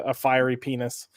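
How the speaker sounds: normal talking voice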